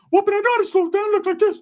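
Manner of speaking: very high, squeaky protest; wired cartoon-high cousin insisting; [name]. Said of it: "[name]'s fucking just on a good one".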